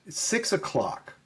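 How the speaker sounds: retold mild irritation; a little irritated. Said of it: "now he's a little irritated".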